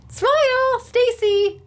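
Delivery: high, loud playful call. Real, not acted. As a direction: shout it across the room with a grin. Calling out to camera operator.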